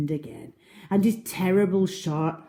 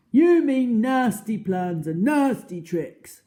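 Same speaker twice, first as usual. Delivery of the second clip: Trunky; 8 semitones higher, 7 dB louder, slower than usual. Acted. Trunky scolds the crocodile.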